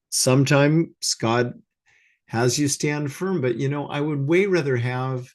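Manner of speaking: ordinary delivery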